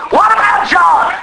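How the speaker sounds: Peter's protest. Retold or imitated. Peter still fixed on John, high insistent protest. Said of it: "Peter's interest is".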